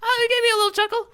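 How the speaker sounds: squeaky high, fast mock voice; mock high chuckle voice. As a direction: pitch it way up, playful. Teasing reply about chuckling.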